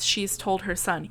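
ordinary talk, nothing marked

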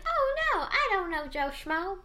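high-pitched, drawn-out mock denial; acted innocent denial. Stranger asked whether they know someone, mock innocent denial.

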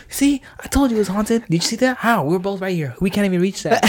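buddy insists the place is haunted, high and excited. Retold or imitated. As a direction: squeak it out like a spooked friend. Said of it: "He's like".

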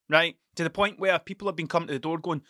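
ordinary talk

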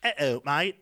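loud, chopped-off British; chopped British accent. Shown as a demonstration. Co-host shows how British words get chopped, loud British accent. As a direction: cut the words short in British style. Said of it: "chopped, like".

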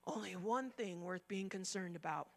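soft, low restatement; hushed. Shown as a demonstration. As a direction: drop it to a quiet echo. Softer restatement.

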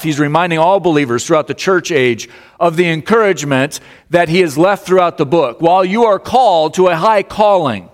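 high, driving sermon voice; high-pitched, ringing call to the church; impassioned preaching. Real, not acted. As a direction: exhort the congregation with mounting force. Preaches at full force.

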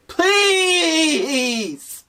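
high, wailing mock plea; at wits end. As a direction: beg like it's life or death. Mock begging viewers to share.

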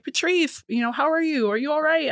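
high, caring runner's check-in; lightly voiced worried friend; concerned friend. Second runner checks on her.

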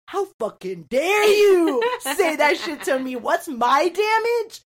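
slow, high, affronted outburst; outraged retort. Retold or imitated. Sister throws the insult back, drawn-out high outrage. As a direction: stretch each word in high, loud indignation.

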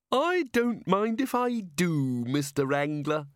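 high, slow, genteel lady voice; coy fairy. A narrator's reading. Cheerful fairy accepting wine from her host, coy and high. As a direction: accept sweetly in a high, drawn-out voice.

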